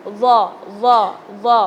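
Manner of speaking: "Za" said thick and heavy, tongue between the teeth; heavy emphatic "za", a deep full sound. Shown as a demonstration. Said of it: "Huruf yang harus dibaca tebal".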